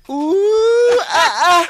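very high, loud "ooh" with short yelps; imitated audience squeal. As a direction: mimic a Cape Town woman's whoop. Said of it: "I always hear them go like".

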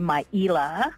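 "Maila" said as my-EE-luh; wrong name "Maila" split into three syllables; mispronounced name. Shown as a demonstration. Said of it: "or they would say Maila".

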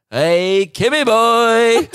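drawn-out mock shout from the crowd; distant heckle. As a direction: call it out like a heckler far off. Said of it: "hear in the distance".

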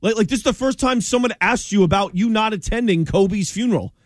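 high-pitched, incredulous comeback; exasperated, pitch riding high; indignant challenge. Acted. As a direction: challenge him as if he were in the room. Challenging LeBron's quoted answer as absent.

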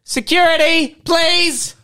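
loud urgent call; calling for security. Pretend. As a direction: shout for the guard.

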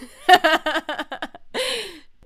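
loud, high burst of laughter; real delighted cackle; laughing. Real, not acted. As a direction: crack up at being found out. Laughing at being found out.

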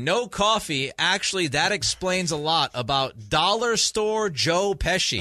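high, loud, mock-outraged reading; mock indignant read. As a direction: read the text like a scandalized host. Reading an insulting listener text.

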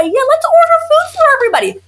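high, fast sarcastic mimic of pushy guests; mocking in-laws. New mom mocking visiting in-laws, sarcastic high mimicry. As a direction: squeal it out like a clueless relative.

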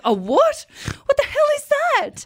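very high, loud incredulous squeal; outraged high-pitched what; shocked disbelief. Retold or imitated. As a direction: shriek it in horrified disbelief. Retold shocked reaction to diagnosis.

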